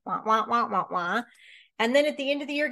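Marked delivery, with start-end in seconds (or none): 0.1-1.2 muted trombone wah wah, lightly mimicked; Charlie Brown adult voice. Coach mocking fuzzy goals with a cartoon wah-wah. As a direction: play the muffled adult voice from the cartoon. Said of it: "Charlie Brown's bomb".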